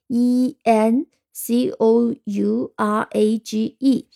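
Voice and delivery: slow, even letters of "E N C O U R A G E"; spelled letter by letter. Shown as a demonstration. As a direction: spell each letter slowly and evenly. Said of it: "我们可以用两种方式".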